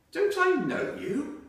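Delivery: high, slow, friendly question with a laugh in it; hopeful friendly greeting with a little laugh; friendly smile. Acted. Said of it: "with what he hoped was a friendly smile".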